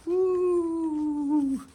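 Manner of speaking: high joyful whoop; excited whoop. Real, not acted. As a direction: cheer with delight. Cheering at wheels fitted.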